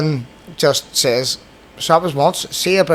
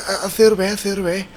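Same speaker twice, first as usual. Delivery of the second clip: high mock panic from the cornered thief; imitating a scared thief blurting excuses; panicked thief; 8 semitones higher, much faster than usual. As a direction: stammer it out higher, frightened. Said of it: "He was freaking out".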